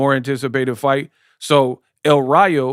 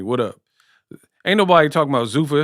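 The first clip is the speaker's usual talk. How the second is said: usual speaking voice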